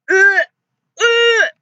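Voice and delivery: high, loud ughs of disgust; loud disgusted groans. Real, not acted. Player recoiling from a gross sight, loud disgusted ughs. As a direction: retch out each ugh in revulsion.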